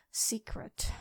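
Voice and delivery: breathy whispered secret; menacing whisper. Acted. Dragon whispering to the hero she can't let go. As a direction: whisper it like a threat.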